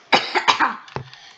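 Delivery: sharp real cough; coughing fit. Real, not acted. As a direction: cough hard mid-sentence. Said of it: "Sorry I'm coughing so much".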